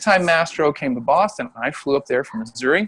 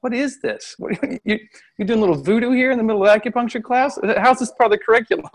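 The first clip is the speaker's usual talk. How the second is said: classmates' disbelief, pitched up and a bit quick; mock incredulous; 7 semitones higher than usual. Classmates scoffing at the voodoo doll, mock incredulous. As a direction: play the baffled student scoffing at the teacher. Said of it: "my classmates were looking at him like".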